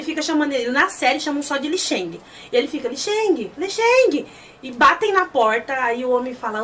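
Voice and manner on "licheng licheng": high imitated plea for help; retold cry for rescue; desperate calling out. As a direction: call the name out, climbing higher. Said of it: "começa a chamar o Wilson".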